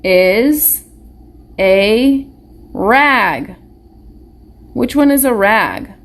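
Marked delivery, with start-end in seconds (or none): 0.0-3.6 put-on slow choral reading for young readers; word-by-word reading. Shown as a demonstration. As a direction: read "This is a rag" one word at a time, pausing after each. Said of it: "Let's read what we're gonna circle".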